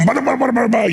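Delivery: high, rattled-off mock speech; imitated foreign chatter. Imitating a leader suddenly talking to his interpreter, mock chatter. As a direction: babble nonsense syllables as mock Russian. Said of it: "the interpreter starts telling me what he's saying".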